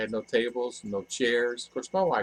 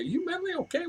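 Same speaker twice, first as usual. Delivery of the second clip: much higher, softer mock mom voice; imitating mom; 4 dB quieter, faster than usual. Mother doubting son's plan to leave, mock worried. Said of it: "My mom would say".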